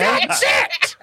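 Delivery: squawking angry crow, shouted high. Shown as a demonstration. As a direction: caw the words like a furious crow. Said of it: "make yourself sound like you're an angry crow".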